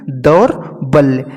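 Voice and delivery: दौर्बल्य slowly, split as daur, balya; broken into parts. Shown as a demonstration. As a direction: leave a gap inside दौर्बल्य. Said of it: "क्या होता है?".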